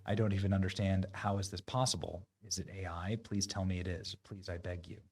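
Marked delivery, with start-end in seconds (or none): none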